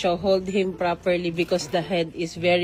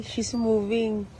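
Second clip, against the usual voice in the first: usual speaking voice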